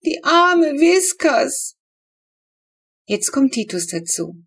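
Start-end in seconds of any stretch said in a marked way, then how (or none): 0.0-1.8 high, loud, slow grieving wail; wailing lament. A narrator's reading. Girl lamenting her supposedly dead cat, slow wail. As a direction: stretch it out in a wail. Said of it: "wehklagt Flo".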